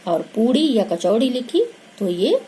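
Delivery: normal talking voice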